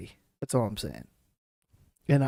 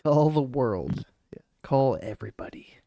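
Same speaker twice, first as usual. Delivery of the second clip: whispering. Real, not acted. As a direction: whisper it off-mic. Muttered aside while call connects.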